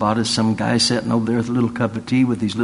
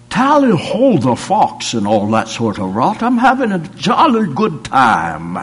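hammed-up posh British accent, higher and louder; mock British accent; 7 semitones higher, 6 dB louder than usual. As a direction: play the returned Texan gone British. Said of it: "You said you wasn't gonna talk like that".

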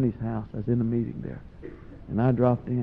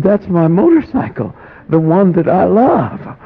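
louder, higher dismayed protest; shocked protest; 7 semitones higher, 7 dB louder than usual. Retold or imitated. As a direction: protest losing what you love. Lenny protesting losing motorcycle.

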